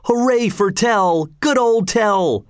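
high, slow, drawn-out hurrah; crowd cheer. A narrator's reading. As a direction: cheer like a whole crowd, stretching the words. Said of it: "shouted the crowd".

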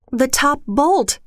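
a high, slow call down the stairs; loud cry. Acted. Wife on a chair calling to her husband for help, high and slow. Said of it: "she cried loudly".

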